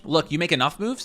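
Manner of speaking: ordinary talk, nothing marked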